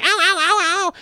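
quick, high run of mock cat howls; ordinary cat yowl. Owner showing what the squashed cat didn't do, quick mock yowling. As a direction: yelp rapidly like a hurt cat. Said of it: "all accordion shaped and shit".

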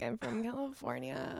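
put-on slow creaky Valley girl voice; vocal fry. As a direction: drag it out with heavy fry. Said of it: "I just wanna be like".

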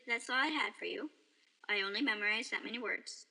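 quick, rushed brush-off. Acted. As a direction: rattle it off to escape. Stranger breaks character.

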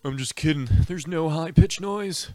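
lightly voiced bully kid; a hint of a cocky kid; [name] voice. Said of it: "here's a little thing of [name] talking".

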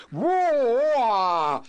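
loud, rising blustering "Wah!"; mock bluster cry. Host mocking a puffed-up Trump, loud mock bellow. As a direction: ham up a puffed-up bully's roar.

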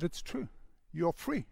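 a quiet, tender confirmation; tender reassurance. Retold or imitated. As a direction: reassure her almost under your breath. Man gently reassures her.

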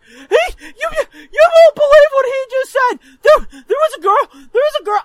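high, loud, stammering whine; imitating a worked-up kid telling on someone; frantic tattling brother. Brother running to mom, frantic stammering tattle. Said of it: "my brother's like".

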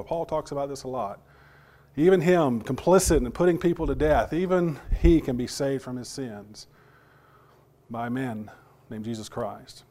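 ordinary delivery

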